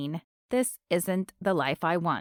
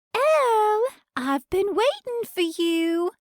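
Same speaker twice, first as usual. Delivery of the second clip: shrill Cockney sing-song; 13 semitones higher, 4 dB louder than usual. Acted. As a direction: croon the name in a high Cockney lilt. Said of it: "a shrill Cockney voice sing-songs".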